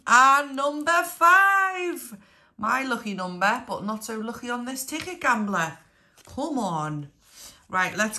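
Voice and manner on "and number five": bingo caller. Acted. Drawn-out bingo number call.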